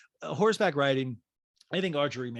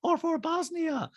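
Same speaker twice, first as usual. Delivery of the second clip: put-on very high-pitched accented caricature; squeaky falsetto mimicry of a reality star; Diana impression; 4 dB louder than usual. Host mimics reality star in recap, high-pitched caricature. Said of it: "And Diana goes".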